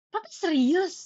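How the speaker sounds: high, loud incredulous question; retold shock. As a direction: blurt it out in acted disbelief. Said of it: "aku, aku terkejut".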